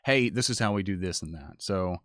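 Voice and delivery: usual speaking voice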